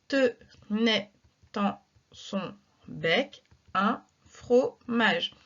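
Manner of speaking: very slow, word by word scansion; slow verse reading. Shown as a demonstration. Teacher reads La Fontaine slowly for syllable counting. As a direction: draw out each syllable so pupils can count. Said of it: "E muet à la fin du vers".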